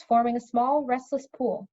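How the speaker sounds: ordinary delivery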